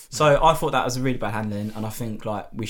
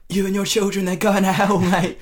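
higher, louder mock preaching; preachy telling-off; 6 semitones higher, 4 dB louder than usual. Retelling warning mum's friend in the car, raised preaching. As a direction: lecture her about hell, raised. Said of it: "And I say to her".

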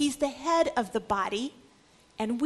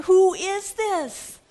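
slow, louder, awestruck question; stunned wonder; 7 semitones higher, 7 dB louder, much slower than usual. Acted. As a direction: draw out the question in amazement. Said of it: "they asked each other".

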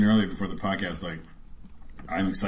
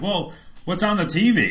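mock surprise; 11 semitones higher, 4 dB louder than usual. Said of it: "You're like".

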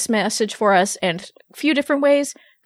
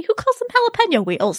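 mock disbelief; 12 semitones higher than usual. Real, not acted. Mock-outraged about recipe wording.

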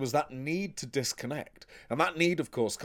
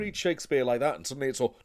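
normal talking voice

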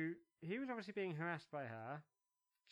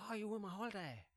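mock girlfriend voice; 5 semitones higher than usual. Mocking the shopping girlfriend.

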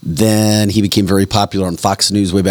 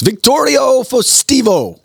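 very high, drawn-out name announcement; mock Spanish DJ; slower than usual. Host announcing his new Spanish DJ name, high and drawn out. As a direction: stretch the name high like a showy DJ.

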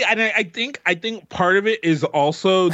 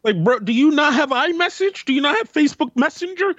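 exasperated rant; 8 semitones higher than usual. Real, not acted. Exasperated rant about calls.

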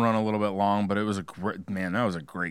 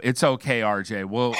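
ordinary talk, nothing marked

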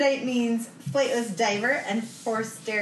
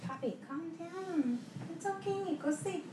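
soothing pet; 9 semitones higher, 11 dB quieter than usual. Real, not acted. Hushing pet during recording.

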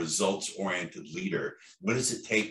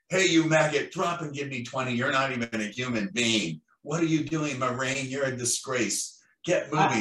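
barking drill sergeant, higher and louder; Marine drill sergeant; 5 semitones higher, 3 dB louder than usual. Retold or imitated. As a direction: bark orders like a Hollywood drill instructor. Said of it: "the Hollywood image of the Marine".

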